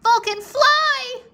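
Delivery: high, loud, slow plea; pleading cry. A narrator's reading. Rory begging frozen Vulcan to fly, a high loud slow plea. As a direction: beg the frozen dragon to move. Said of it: "Rory pleaded".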